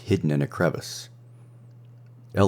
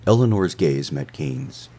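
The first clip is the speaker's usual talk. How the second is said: ordinary delivery, nothing marked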